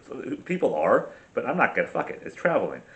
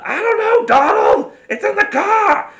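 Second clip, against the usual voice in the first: put-on Boston accent, pitched much higher and louder; mock Boston reply; 8 dB louder, slower than usual. Woman yelling back to Donald at 3am, mock Boston accent, high and loud. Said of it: "with that accent".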